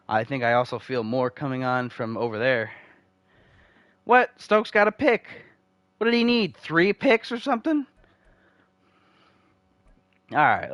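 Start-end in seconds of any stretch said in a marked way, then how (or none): 4.0-7.9 jumping up in surprise at the pick; surprised. Real, not acted. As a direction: caught off guard by a pick. Sudden game event.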